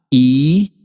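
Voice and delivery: "i" as its own long syllable. Shown as a demonstration. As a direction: hold "i" alone between pauses. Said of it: "vamos separar bainha?". ("i" isolado e alongado, separando sílabas)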